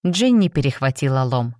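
ordinary delivery, nothing marked

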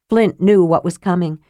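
plain reading voice

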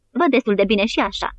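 usual speaking voice

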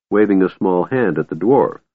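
ordinary delivery, nothing marked

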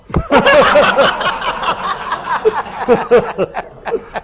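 long, high burst of laughing; loud laughter. Real, not acted. Friends laughing at the Olympic condom joke, long loud laugh.

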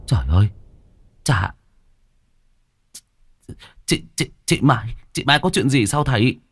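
halting, frightened question; stammering shock. Acted. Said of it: "Cậu sững người ấp úng". (ấp úng sững sờ)

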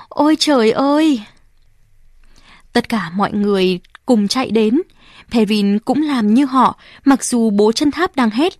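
usual speaking voice

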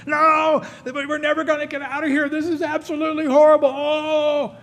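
high, loud panicked wailing; panicking. Acted. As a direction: freak out in full panic, voice way up. Panicking person locked in room.

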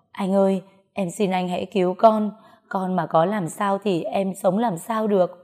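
plain reading voice

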